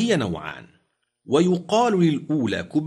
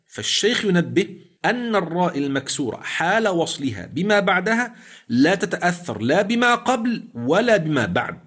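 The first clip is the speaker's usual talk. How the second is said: normal talking voice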